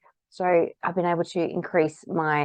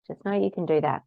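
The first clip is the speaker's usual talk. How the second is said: ordinary talk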